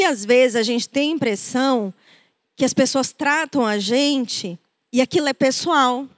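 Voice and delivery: ordinary delivery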